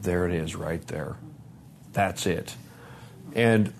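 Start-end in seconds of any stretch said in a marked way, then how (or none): 0.0-2.5 low, soft recalled thought; hushed realization. Retold or imitated. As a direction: say it quietly to yourself, dropped low. Recalled sobering inner thought.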